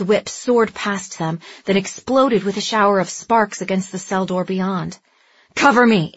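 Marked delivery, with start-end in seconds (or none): 5.5-6.1 loud snarling command; growled. A narrator's reading. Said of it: "Andy growled".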